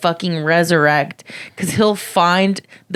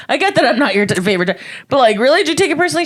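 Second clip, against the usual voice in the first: usual speaking voice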